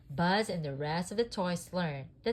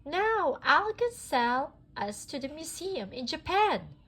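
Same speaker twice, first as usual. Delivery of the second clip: put-on high, louder old prospector; 10 semitones higher, 5 dB louder than usual. A narrator's reading. Prospector telling the gang, high put-on voice. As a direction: play the scheming old prospector. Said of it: "said the prospector".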